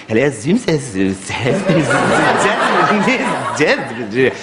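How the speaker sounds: low, drawn-out crooning hotel greeting; fancy hotel phone greeting. Retold or imitated. Hotel operator crooning a greeting to a guest, slow and low. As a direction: croon it like a posh receptionist. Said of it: "This is how they answer the phone".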